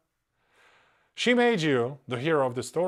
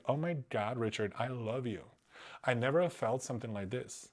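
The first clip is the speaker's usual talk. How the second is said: softly mocking a gushing lover, much quieter; fake adoring woman; 2 semitones lower, 9 dB quieter than usual. Streamer imitating the scammer's pretend love for Richard, soft mock gush. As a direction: coo it with obvious fake sweetness. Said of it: "She'll pretend like she'll love".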